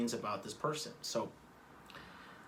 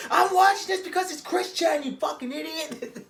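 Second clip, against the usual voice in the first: high, loud retorting shout; snapping back at the brother, loud and high; yelling back; 11 dB louder than usual. Retold or imitated. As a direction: yell back at him, exasperated.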